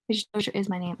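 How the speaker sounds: soft throwaway reread; muttered repeat. Real, not acted. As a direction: say it under your breath to yourself. Reader mutters the line over.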